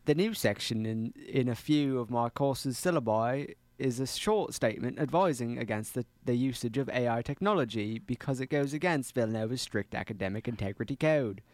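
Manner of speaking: put-on careful Australian accent. Player reads a paragraph for the game, Australian accent. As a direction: read aloud with an Australian accent.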